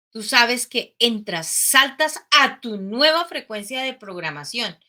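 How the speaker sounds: usual speaking voice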